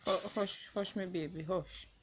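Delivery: soothing. Real, not acted. Prankster comforting crying partner, soothing hush. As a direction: hush a crying loved one gently.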